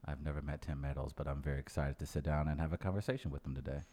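sheepish and quiet. Real, not acted. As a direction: concede it quietly, caught out. Caught-out, sheepish, much quieter.

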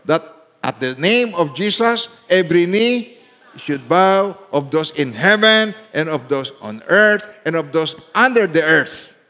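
slow, loud unison reading of scripture; reading aloud together. Shown as a demonstration. Preacher leading congregation in reading, slow and loud. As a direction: lead the church through the verse slowly. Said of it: "Sabay-sabay, basahin ninyo".

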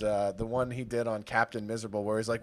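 usual speaking voice

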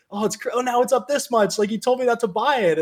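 hammed-up panicky buyer, pitched high; whiny newbie. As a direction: imitate a flustered newbie investor, voice pitched well up. People complaining stock went up.

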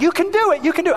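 high, eager cheering; encouraging shout. Retold or imitated. Dad cheering his kid riding a bike, high and eager. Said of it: "I'd be".

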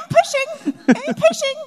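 very high falsetto, feigned effort; fake pushing. New mother pretending to push for the nurses, fake effort. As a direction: squeak it out as a badly faked strain. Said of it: "Like, it wasn't a real".

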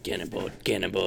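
a hint of a scratchy old guy chant; mild imitation of a scraggly voice. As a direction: lightly mimic a raspy caller. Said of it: "said it in a really scraggly voice".

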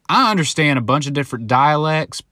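usual speaking voice

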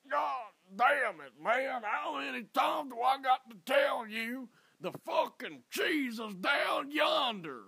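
loud, slow, high shouting Carolina local; angry local. Retold or imitated. A man yelling at a lost stranger where the cheese is, furious shout. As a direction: bellow it like an exasperated local giving directions.

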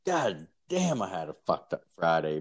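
ordinary delivery

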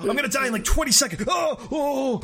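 high-pitched comic groaning panic; mock dying. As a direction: moan and wail as if about to keel over. Acting out dying after a cigarette.

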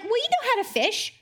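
high, light quoting the disciples; mock encouragement. Preacher voicing disciples urging each other, lifted mock encouragement. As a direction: egg them on in a lifted, mocking voice. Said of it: "they're like".

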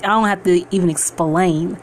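usual speaking voice

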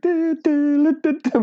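high hummed band tune; marching band hum. Retold or imitated. Mimicking the marching band that greeted them. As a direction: toot it like a parade band.

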